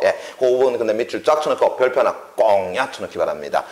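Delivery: ordinary delivery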